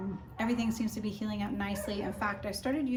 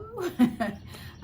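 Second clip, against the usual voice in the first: short laugh; 10 semitones higher, 5 dB louder than usual. Real, not acted. Laughing after retold "No.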